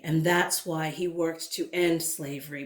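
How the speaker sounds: usual speaking voice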